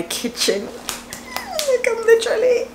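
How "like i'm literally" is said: fast high squeal of disgust; a shriek of creeped-out panic; squealing shudder. Real, not acted. Recalling the snake to viewers, high fast squeal. Shivering at the memory.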